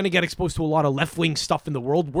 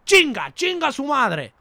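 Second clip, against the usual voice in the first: mock Spanish curse; 9 semitones higher, 4 dB louder, slower than usual. Shown as a demonstration. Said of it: "rattle it off".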